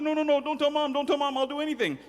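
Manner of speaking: high, begging voice; pleading boy. Acted. Boy begging his sister not to tell Mom, high. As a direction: beg her not to tell.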